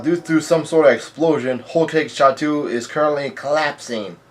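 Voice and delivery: plain reading voice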